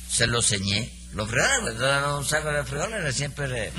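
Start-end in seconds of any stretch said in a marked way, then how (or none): none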